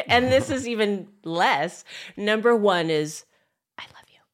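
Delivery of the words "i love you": barely audible whisper. Shown as a demonstration. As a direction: whisper it for one person only. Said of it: "top five words to whisper".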